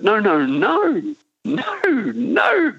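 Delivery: rising, mock-incredulous no; emphatic no. Real, not acted. Rejecting the obvious reading.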